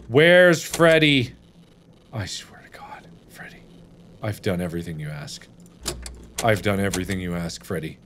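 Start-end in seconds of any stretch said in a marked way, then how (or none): 2.1-8.0 muttered curses. Real, not acted. Muttering quietly at Freddy.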